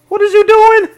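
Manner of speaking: high, loud cry of disbelief; loud, high-pitched exasperated outburst; exasperated yell. Real, not acted. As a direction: yell it in alarm at a blunder. Alarmed reaction to game character's blunder.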